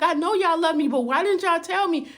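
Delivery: demanding why nobody told her; upset protest. Retold or imitated. Daughter pressing her aunties, upset and loud.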